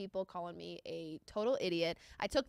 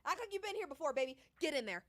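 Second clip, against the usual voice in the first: ordinary talk, nothing marked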